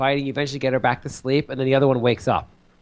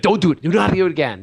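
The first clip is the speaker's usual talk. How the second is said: higher, louder firm telling-off; scolding parent; 5 semitones higher, 4 dB louder than usual. Retold or imitated. Parent to son about the lights, stern scolding. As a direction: lay down the law to a small child.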